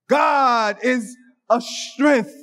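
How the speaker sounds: very slow, drawn-out declaration; slow, weighty God is a strength; slow proclamation. Acted. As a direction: stretch every word out, solemn.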